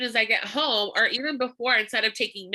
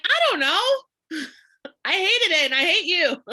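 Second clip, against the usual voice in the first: high, louder laughing "I hated it and I hate you"; mock lead laughing; 7 semitones higher, 4 dB louder than usual. A lead jokingly insults the seller, laughing high. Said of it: "They're like".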